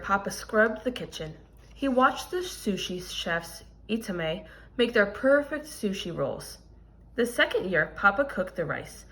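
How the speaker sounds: ordinary talk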